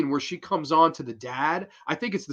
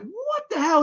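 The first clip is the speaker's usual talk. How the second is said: startled high exclamation. Retold or imitated. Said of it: "he's like".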